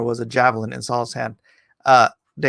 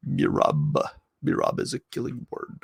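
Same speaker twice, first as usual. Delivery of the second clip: mock-ominous; 6 semitones lower, 6 dB quieter than usual. Jokes on the odd name.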